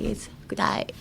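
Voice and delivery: whispered cutesy "It's kudai"; breathy baby-talk whisper. Shown as a demonstration. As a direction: coo it like a baby to a partner. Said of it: "in a baby voice".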